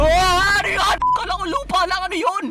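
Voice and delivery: high, alarmed yelp; startled. Real, not acted. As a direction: cry out in sudden fright. Scared by what seemed only dirt. (gulat at mataas ang boses)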